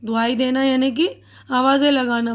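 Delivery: usual speaking voice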